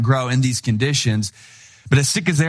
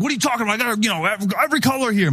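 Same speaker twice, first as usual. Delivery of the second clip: high, heated retort of the seller; mock-angry pushback, pitched way up; imitating an irritated gang seller, voice climbing high; getting angry; 8 semitones higher, much faster than usual. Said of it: "he started getting angry at this point".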